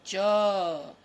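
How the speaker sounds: calls the letter out loud for the class. Shown as a demonstration. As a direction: ring the letter out so the kids repeat it. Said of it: "तो यहाँ लिखेंगे".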